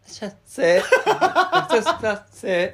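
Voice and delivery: shop clerk greeting. Shown as a demonstration. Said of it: "もう一回やるよ".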